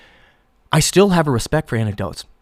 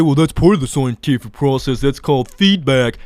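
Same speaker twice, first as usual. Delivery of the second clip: mocking quote. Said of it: "You would say".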